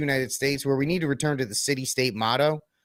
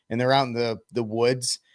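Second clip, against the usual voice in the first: ordinary talk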